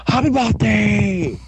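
high, drawn-out character shout-out; gleeful greeting. Acted. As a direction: sing out a put-on birthday greeting. Cake Boss presents the cake.

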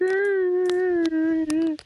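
long, very high imitated bawl; stretched-out hammed-up sob noise; mock crying wail. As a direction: wail like a cartoon crybaby. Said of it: "he's just crying".

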